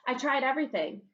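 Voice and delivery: ordinary delivery, nothing marked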